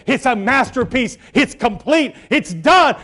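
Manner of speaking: usual speaking voice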